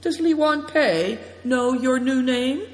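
light, high-pitched casual question. A narrator's reading. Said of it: "he asked casually".

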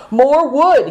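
brisk, high order; commanding. A narrator's reading. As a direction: bark the order. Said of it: "he ordered".

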